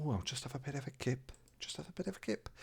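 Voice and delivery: easygoing talking-to-yourself tone; casual retold thought. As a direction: voice a carefree passing thought, softly. Acting out his own thought.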